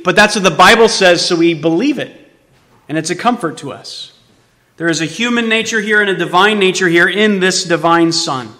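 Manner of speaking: ordinary talk, nothing marked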